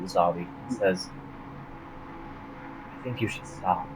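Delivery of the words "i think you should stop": soft command. Acted. GM voices siren command.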